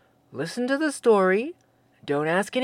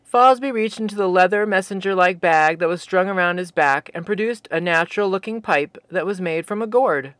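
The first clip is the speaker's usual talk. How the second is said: usual speaking voice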